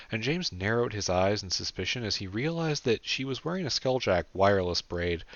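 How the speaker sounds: ordinary delivery, nothing marked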